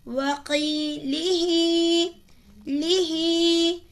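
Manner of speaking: لی said short, ہی drawn out slowly; stretched ہی. Shown as a demonstration. Teacher shows that only the ہی is stretched. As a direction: keep لی short and hold ہی for two counts. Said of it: "کھڑی زیر کو کھینچا".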